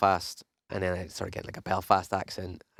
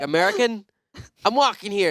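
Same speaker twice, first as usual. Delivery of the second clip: higher, louder mock New York accent; hammed-up New York accent; 12 semitones higher, 5 dB louder than usual. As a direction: shout it like a brash New Yorker. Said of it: "I would have done that accent".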